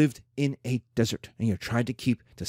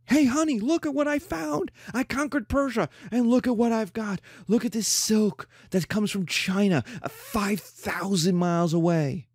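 conqueror showing off; 6 semitones higher than usual. Acted. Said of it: "This is Persian".

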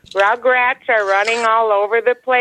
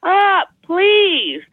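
exasperated plea; 9 semitones higher, much slower than usual. Retold or imitated. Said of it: "and you're like".

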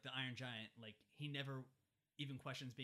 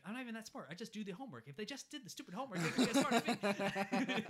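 put-on higher kid voice ranting, laughing at the end; a put-upon schoolboy venting, pitched up; kid's whiny rant; 8 semitones higher, 9 dB louder than usual. Hogarth complaining to Dean about school, higher whiny kid voice. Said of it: "he's like".

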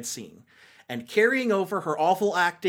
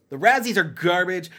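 normal talking voice

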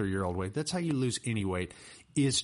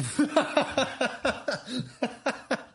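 loud, high real laugh; host laughing hard at his cohost; burst of laughter; 4 dB louder than usual. Real, not acted. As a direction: crack up at the joke. Host cracks up at joke.

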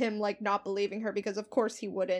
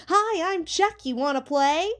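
high, singsong doll greeting; put-on high-pitched doll voice, louder; Chucky; 7 semitones higher, 5 dB louder than usual. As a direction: chirp it like a toy doll come to life. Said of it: "his head snaps around and goes".

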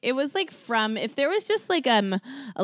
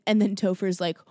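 normal talking voice

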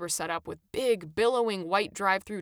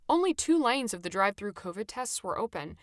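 plain reading voice

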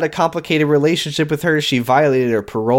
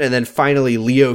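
usual speaking voice

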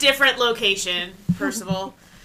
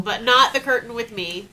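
ordinary delivery

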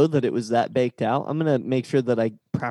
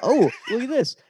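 laughing; 10 semitones higher than usual. Real, not acted. Laughing at the matchup list.

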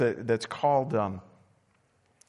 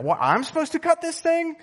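jumps way higher in retold disbelief; shocked disbelief; 13 semitones higher than usual. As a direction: squeak it out, stunned he has to cut the cord. Handed scissors for umbilical cord.

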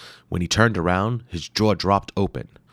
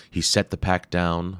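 plain reading voice

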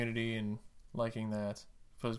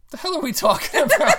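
laughing; 13 semitones higher, 11 dB louder than usual. Real, not acted. Bursts out laughing.

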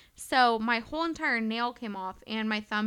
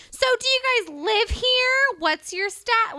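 high, louder prying lady voice; mock Karen voice; 11 semitones higher, 9 dB louder than usual. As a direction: play the nosy neighbour grilling her. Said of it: "And she goes".

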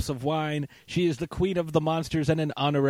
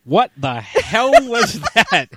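higher, louder, slow words broken by laughter; cracking up at a bad impression; laughing in disbelief; 6 semitones higher, 11 dB louder, slower than usual. Real, not acted. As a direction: crack up while asking. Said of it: "You sounded like".

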